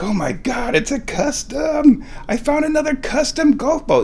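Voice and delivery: high-pitched thrilled exclamation; freaking out. Retold or imitated. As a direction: re-enact a giddy discovery, voice way up high. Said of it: "And I'm freaking out".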